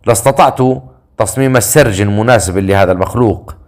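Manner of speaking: plain reading voice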